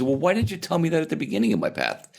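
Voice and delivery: high, quick protesting voice; Dorothy. Acted. Seeker questioning the good witch, high indignant protest. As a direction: blurt it high and fast in mock complaint. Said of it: "But Dory says".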